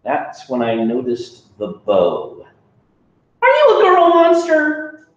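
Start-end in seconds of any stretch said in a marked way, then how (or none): 3.4-5.1 high, loud startled question; a high, loud kid suddenly surprised. Acted. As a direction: blurt it out in disbelief.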